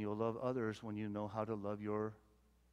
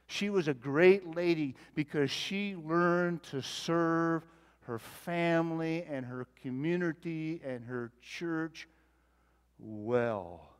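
higher, louder preaching in full flow; preacher rousing the congregation, higher and louder; fervent preaching; 5 semitones higher, 7 dB louder than usual. Acted. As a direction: drive the point home to the congregation. Preacher builds to full force.

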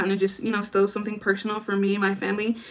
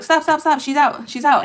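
retold yelling; 5 semitones higher, 4 dB louder than usual. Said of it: "started yelling at me".

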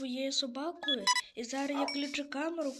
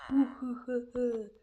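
drawn-out villain's heh-heh-heh; slow sinister laugh in an odd voice; slow evil chuckle; slower than usual. Acted. Monster laughs menacingly. (повільний лиховісний смішок лиходія)

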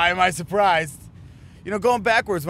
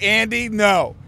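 louder, higher mock scolding; acted firm warning; 6 semitones higher, 7 dB louder, slower than usual. Teller warns sleepwalking roommate at the door, mock scolding. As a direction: call out a retold warning to a roommate.

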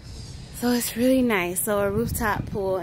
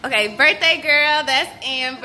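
calling out; 5 semitones higher, 9 dB louder than usual. Real, not acted. Hyping up friends, calling out.

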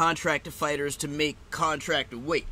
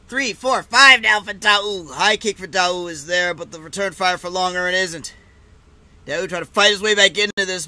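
loud, raised-pitch fight commentary; excited play-by-play; 4 semitones higher, 11 dB louder than usual. Real, not acted. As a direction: call the exchanges live, fired up.

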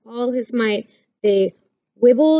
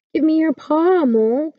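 high, mild storybook shout; 6 semitones higher than usual. A narrator's reading. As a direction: call out urgently to a friend. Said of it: "shouted Little Hedgehog".

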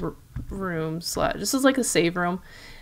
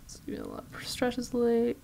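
whispering; 3 semitones higher, 8 dB quieter than usual. Real, not acted. Reading game note.

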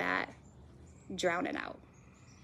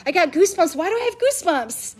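amazed worshipper; 8 semitones higher, 5 dB louder than usual. Retold or imitated. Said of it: "You're like".

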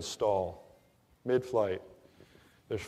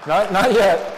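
much higher and louder, eager to go on; loud, high, laughing interruption; excited; 7 semitones higher, 11 dB louder, slower than usual. Real, not acted. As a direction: jump in loud and high to hold the crowd. Audience laughing, teller cuts in.

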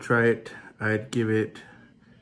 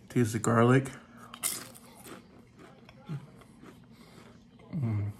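biting chip with salsa; talking while chewing. Real, not acted. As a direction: mouth partly full. Seen 0: Mouth moves while chewing; chip with salsa at lips 3.9–5.8.

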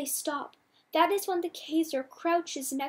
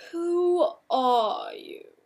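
very slow, drawn-out and louder, looming; Kaiser asking calmly; 7 dB louder, much slower than usual. A narrator's reading. The Kaiser looming over the child Theo, slow and menacing. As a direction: stretch each word like a cold interrogator. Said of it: "the Kaiser asks calmly".

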